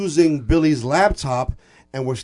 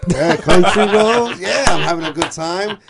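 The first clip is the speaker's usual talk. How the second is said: mock cheerful; 5 semitones higher, 4 dB louder, slower than usual. Retold singing along happily.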